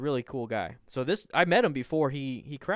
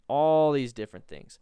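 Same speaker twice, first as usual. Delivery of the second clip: louder for emphasis; 2 semitones higher, 7 dB louder than usual. Real, not acted. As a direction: stress on all, louder. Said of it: "repeated louder".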